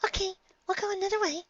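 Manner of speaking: very high, fast squeaky voice; squeak. A narrator's reading. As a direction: squeak it out in a rush. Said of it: "Fireside squeaked".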